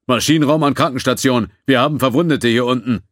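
high, loud urgent call over intercom; man down shout. Acted. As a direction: call for help from engineering. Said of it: "Eine unbekannte Männerstimme ertönte über das Intercom".

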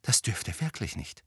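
hushed, soft plea; quiet, earnest warning; whispering. A narrator's reading. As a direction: keep it under your breath. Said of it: "flüsterte Hermine".

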